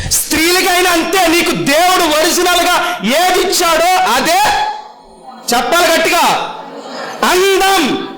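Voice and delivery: high, slowed emphatic preaching. Real, not acted. Pastor telling women God's beauty is true beauty, emphatic preaching. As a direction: drive the point home slowly.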